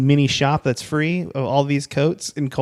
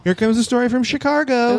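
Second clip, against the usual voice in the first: upbeat announcement; 9 semitones higher than usual. Acted. Announcing next story.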